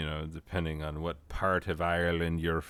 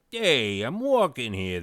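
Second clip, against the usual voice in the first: put-on New York accent; 6 semitones higher, 6 dB louder than usual. Searching for an example, shouted New York accent. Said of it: "a New York accent that's not like".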